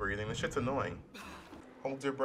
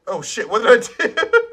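shocked outburst; 11 semitones higher, 13 dB louder than usual. Real, not acted. Accidentally kills someone.

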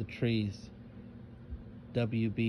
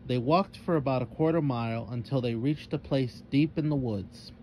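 ordinary delivery, nothing marked

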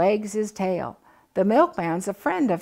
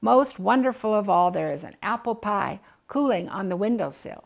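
ordinary delivery, nothing marked

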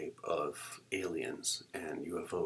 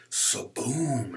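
punched, drawn-out sound effect, louder; imitated slashing sound; 5 semitones higher, 7 dB louder than usual. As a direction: hit it like a sudden blow.

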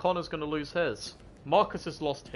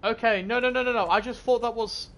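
scared horror game streamer; 6 semitones higher than usual. Real, not acted. Finds corpse in horror game.

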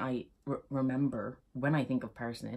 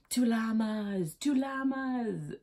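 slow, higher, drawn-out chant of two llamas; slow mock toast, much higher; mishearing toast; 8 semitones higher, much slower than usual. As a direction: stretch it out like a confused drinker. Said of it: "thinks he's saying".

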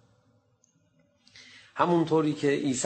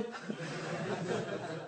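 soft laugh; 5 semitones higher, 10 dB quieter than usual. Real, not acted. Laughter at zeal.

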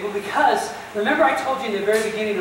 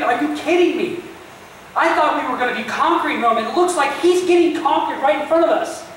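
higher, louder, frantic disbelief; Peter's panicked thoughts; 6 semitones higher, 3 dB louder than usual. Retold or imitated. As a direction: voice Peter's rising panic, pitched up and pushed.

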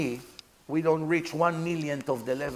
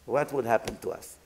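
normal talking voice

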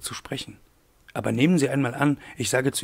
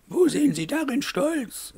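high-pitched put-on lady's voice; challenging mademoiselle; 13 semitones higher than usual. A narrator's reading. Aglaé disputes Wolf's talk of pride, high challenging lady voice. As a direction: question him pointedly, pitched high.

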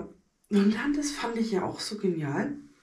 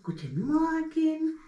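talking to teeth; 8 semitones higher, slower than usual. Pretend. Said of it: "greeting teeth".

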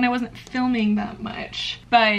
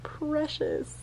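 tender pet talk, softer and higher; doting on the cat; 4 semitones higher, 6 dB quieter than usual. Real, not acted. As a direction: melt over the cat.

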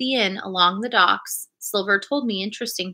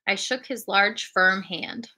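plain reading voice

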